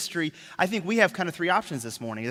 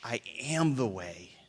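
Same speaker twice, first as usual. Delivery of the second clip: low gentle correction; 5 semitones lower, 5 dB quieter than usual. Retold or imitated. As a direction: calmly set them straight. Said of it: "But Jesus says".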